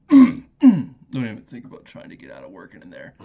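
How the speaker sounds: loud, menacing warning voice; menacing movie quote. Retold or imitated. To coworker Mikey stuck in the cooler, loud menacing warning. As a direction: loom over him with a loud threat. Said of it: "I used to say to him all the time".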